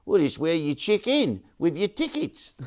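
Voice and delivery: high mock New Zealand accent, "check in" said like "chicken"; punchline in a high Kiwi voice, "check in" squeezed into "chicken". Joke punchline to viewers, in a high mock New Zealand accent. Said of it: "the New Zealand guy".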